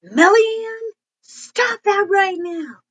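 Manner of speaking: put-on high, slow, stern call to a child; scolding parent. Shown as a demonstration. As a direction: call the child out by full name.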